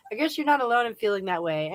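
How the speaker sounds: ordinary delivery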